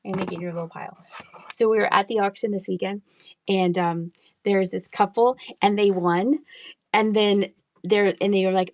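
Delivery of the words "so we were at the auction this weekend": usual speaking voice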